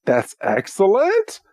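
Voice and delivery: a lightly voiced proud mentor; pleased knight. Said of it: "he's like".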